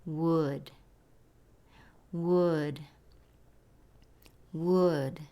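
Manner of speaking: "would" with a silent L, falling tone; pronunciation model. Shown as a demonstration. Teacher repeats would for learners to hear, careful pronunciation demo. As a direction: say "would" like "wood", each one separate. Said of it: "Se pronuncia".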